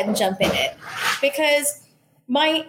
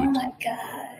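whispered oh my God; 2 semitones higher, 7 dB quieter than usual. Real, not acted. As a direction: breathe it out unvoiced. Overwhelmed by choice.

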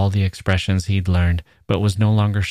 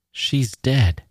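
higher-pitched, uneasy statement; thin thread of fear, pitched up; frightened reply; 6 semitones higher than usual. A narrator's reading. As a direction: answer with mild alarm. Girl reacts to mention of neighbour.

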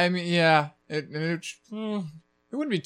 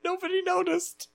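laughing; 4 dB quieter than usual. Real, not acted. Laughing recounting a mistake.

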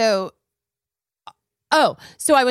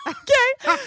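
high okay. Retold or imitated. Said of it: "I'm like".

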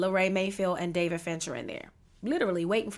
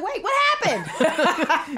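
laughing disbelief; 8 semitones higher, 7 dB louder, much slower than usual. Retold or imitated. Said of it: "and then I'm like".

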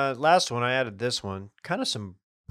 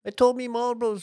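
much higher put-on British voice; mock British accent; 12 semitones higher than usual. Asked to say it like a Brit, high mock British accent. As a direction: squeak it out in a silly British accent. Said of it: "a way Yale Brit would say it".

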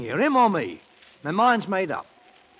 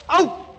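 sudden high yelp as he's bitten; sharp pained yelp when the bride bites him; 11 semitones higher, 7 dB louder than usual. Acted. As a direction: cry out, startled and hurt.

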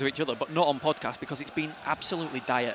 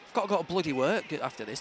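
ordinary talk, nothing marked

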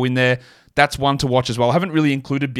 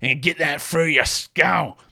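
slow, higher put-on bully voice, drawing out each word; mock aggressive; 4 semitones higher, slower than usual. Host parodying his own advice to listeners, mock aggressive. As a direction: ham up the aggression, stretching "skull". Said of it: "that sounds so aggressive".

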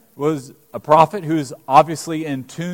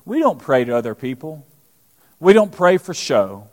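normal talking voice

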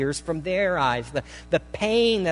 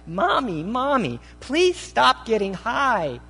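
high, drawn-out plea to a mother; begging; 8 semitones higher, 4 dB louder, slower than usual. Retold or imitated. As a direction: beg like a desperate child, pitched up. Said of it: "begging her".

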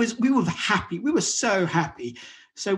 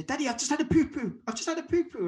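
put-on much higher little-boy voice; toddler voice; 8 semitones higher than usual. Father imitating his potty-training son calling from the living room, high child voice. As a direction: imitate a proud toddler announcing it.

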